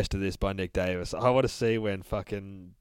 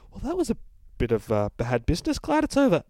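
much higher, fussy relieved voice; prim narrator; 8 semitones higher, 4 dB louder, faster than usual. Acted. Narrator relieved after the boogeyman dies, high and fussy. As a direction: fluster with relief.

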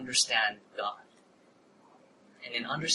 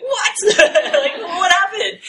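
high, loud, laughing disbelief; mock-stunned outburst at her change of heart; shocked what; 11 dB louder, much slower than usual. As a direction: blurt it out in shock.

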